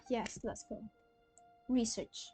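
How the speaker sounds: ordinary talk, nothing marked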